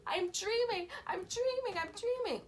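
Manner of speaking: high-pitched acted panic; tearful insistence. Dreamer insisting to doubting parents it's a dream, tearful panic. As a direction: insist desperately, voice way up, mock-tearful. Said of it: "I was crying, like, tears".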